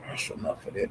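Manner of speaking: unvoiced whisper to herself; whispered aside. Real, not acted. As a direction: breathe it out. Whispered aside before repeating.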